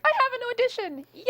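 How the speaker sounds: high-pitched, loud mock cheer; gleeful re-enacted celebration; excited. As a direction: squeal it like thrilling news. Acting out initial joy at audition.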